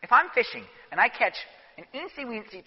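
normal talking voice

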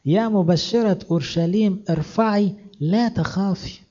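high, slow proclamation; herald's high, drawn-out cry; calling out aloud. Acted. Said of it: "نادي بصوت عالي".

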